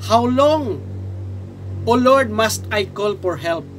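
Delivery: slow, emphatic Bible reading; drawn-out questions to God, falling away at the end; scripture lament. Acted. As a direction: declaim the lament phrase by phrase. Reads Habakkuk's lament aloud.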